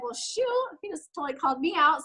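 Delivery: a sudden high "well, shoot", caught out; sheepish realization. Retold or imitated. Retelling being called out by her husband, sheepish high exclamation. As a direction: voice jumps up as she admits he's right. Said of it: "And so I was like".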